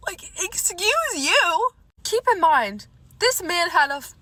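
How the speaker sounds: high, loud outrage; indignant. Real, not acted. Hitting back at the date's body insult, indignant. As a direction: take loud offence, drawing out the first words.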